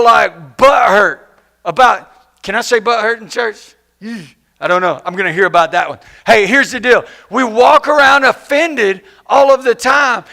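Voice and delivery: normal talking voice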